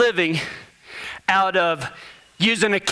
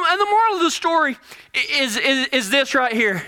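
usual speaking voice

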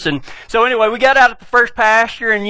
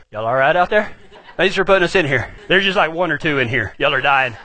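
lower, laughing mock horses; smug horse voice; 7 semitones lower than usual. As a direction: voice the horses teasing from the trailer. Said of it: "Fiona and that other horse are like".

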